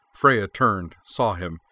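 ordinary delivery

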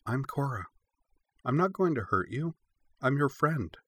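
put-on high, tender child's voice; gentle reassurance. A narrator's reading. Cora introduces herself to a wary puppy, gently reassuring.

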